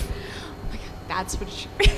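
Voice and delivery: breathy laughing whisper. Real, not acted. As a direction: whisper it through the giggles. Unvoiced aside after joke.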